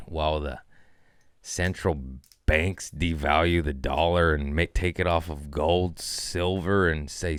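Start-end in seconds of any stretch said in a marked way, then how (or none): none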